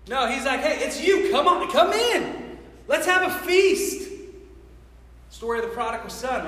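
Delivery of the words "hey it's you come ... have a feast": higher, drawn-out welcoming call; acted delight, beckoning someone in; joyful welcome. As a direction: call them in to celebrate, voice lifting. God acted welcoming someone back home.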